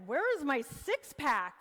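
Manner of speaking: loud, high-pitched mock outrage; mock indignation. Speaker mimics gym beginner expecting results overnight, mock indignation. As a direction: demand it in hammed-up disbelief. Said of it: "you're like".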